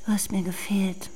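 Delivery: whisper. Acted. Reunion confession.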